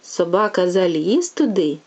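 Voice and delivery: lively, sing-song bedtime tale reading; storytelling to a child. A narrator's reading. Mother reading a fairy tale to her little one, sing-song. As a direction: read it like a fairy tale for a toddler.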